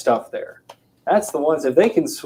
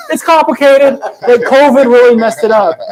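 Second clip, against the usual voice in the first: much higher, loud sheepish excuse; hammed-up husband; mock husband; 11 semitones higher, 9 dB louder than usual. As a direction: wriggle out of it.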